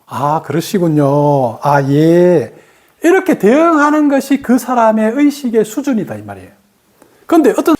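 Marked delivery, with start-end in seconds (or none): 0.0-2.5 low, slow and composed; unhurried, level-headed answer to a shouting customer; calm reply. Shown as a demonstration. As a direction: soothe an angry customer calmly. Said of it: "그러다가도 나는 깨어서".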